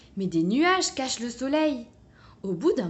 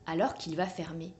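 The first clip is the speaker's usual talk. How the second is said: usual speaking voice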